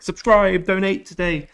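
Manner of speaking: high, loud hammed-up creator begging; mock YouTuber plea. Streamer mocking creators who beg for subscriptions, loud and high. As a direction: parody a pushy YouTuber's pitch. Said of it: "some people who's like".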